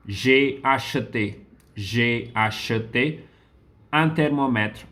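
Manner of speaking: J'ai acheté said slowly twice, each syllable clear; slow model phrasing. Shown as a demonstration. Teacher slowly models j'ai acheté for students. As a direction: spell it out slowly for the class. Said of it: "teacher models the conjugation".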